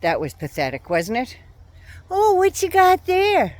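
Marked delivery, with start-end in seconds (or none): 2.1-3.6 mocking politician talking to a kid, high and drawn out; slow, high-pitched fake interest; DeSantis. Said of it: "Did you see him with that little kid?".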